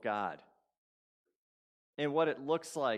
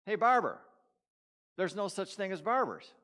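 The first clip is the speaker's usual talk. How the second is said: higher and louder, hailing him across the shop; calling out to the barber; 7 semitones higher, 5 dB louder than usual. Acted. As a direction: call out to get the barber's attention. Pastor calls out to barber.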